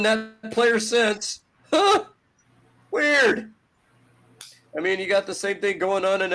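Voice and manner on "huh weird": much higher, sarcastic puzzled huh; mock surprise. Host mocking Boston's sudden improvement, sarcastic fake surprise. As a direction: feign innocent confusion, dripping sarcasm.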